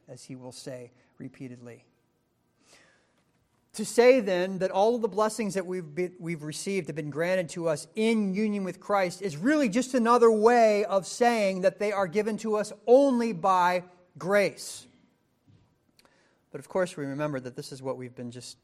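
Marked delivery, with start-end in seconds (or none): 3.7-14.8 loud, higher, driving to the last word; preaching at full force. Acted. Preacher insisting blessings come only by grace, full force. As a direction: land "by grace" like a hammer.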